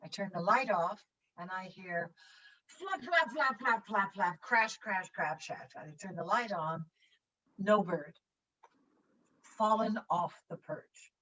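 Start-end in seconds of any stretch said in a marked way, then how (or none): 2.6-5.7 spoken sound effects of wings flapping, then crashes; flapping and crashing. Retold or imitated. Telling the audience about the parrot's fall, mimicking flapping and crashing. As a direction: mimic the parrot tumbling off its perch in the dark. Imitating the parrot falling at night.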